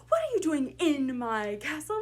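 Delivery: loud line with "in" punched; stress on in. Shown as a demonstration. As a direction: hit the little word "in" hard and loud. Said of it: "pick one word in that sentence to really emphasize".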